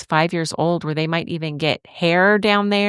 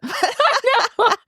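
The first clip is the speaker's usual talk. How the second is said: laughing. Real, not acted. Laughing at the funny remark.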